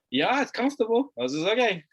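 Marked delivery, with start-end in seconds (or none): 0.1-1.1 lightly voiced young son, higher; a hint of a carefree kid; a kid shrugging it off, pitched up; son's reply. Voicing young son's casual reply. 1.5-1.9 amused okay that breaks into a laugh; laughing along as he lets it go. Real, not acted. Giving in to son, laughing.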